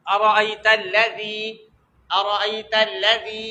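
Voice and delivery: slow recital of "ارأیت الذی" with soft dh, not z; soft dhaal. Shown as a demonstration. Teacher gives an example, "ارأیت الذی" slow with soft dh. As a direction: model "الذی" with tongue-tip dh. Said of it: "ذی، اس کو زی نہیں پڑھیں گے".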